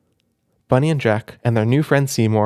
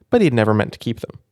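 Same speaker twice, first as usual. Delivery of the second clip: ordinary delivery, nothing marked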